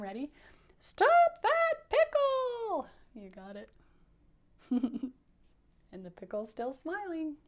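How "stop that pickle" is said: very high, loud, long-drawn holler; crying-out pretzel. Shown as a demonstration. Pretzel joins the chase, high loud drawn-out cry. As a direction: cry out as a pretzel in hot pursuit. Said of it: "Can you do it like him?".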